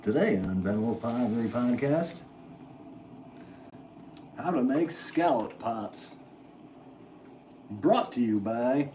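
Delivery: slow, lightly voiced old-time announcer; a hint of a vintage radio host; 1950s radio voice. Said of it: "my generic radio voice".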